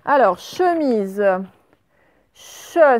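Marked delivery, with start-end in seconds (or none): 2.3-3.0 Che said alone as a punched, louder syllable; isolated syllable. Shown as a demonstration. Teacher sounding out Che for pupils, loud and crisp. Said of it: "c'est le son che".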